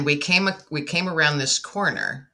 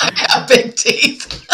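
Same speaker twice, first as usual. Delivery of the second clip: loud, high, slow words through laughter; real laughing; 8 semitones higher, 6 dB louder, slower than usual. Real, not acted. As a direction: crack up while teasing about yourself. Laughs at remark about smile.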